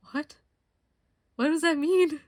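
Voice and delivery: high, loud baffled question; incredulous. Retold or imitated. Confused by the ad's strange wording, high and loud. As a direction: sound truly thrown by it.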